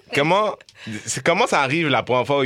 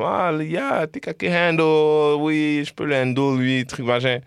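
lightly mocking, higher-pitched voice, drawn out at first; hint of a higher mock voice, easing back down; imitating keen admirers; 6 semitones higher than usual. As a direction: mimic someone eagerly taking up a challenge. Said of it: "Genre, ils sont comme".

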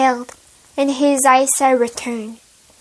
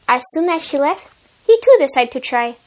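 plain reading voice

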